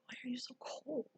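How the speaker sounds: a hushed, unvoiced whisper of disbelief; whispered question. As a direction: whisper it to him as if not to wake anyone. Finding husband cold, kids asleep.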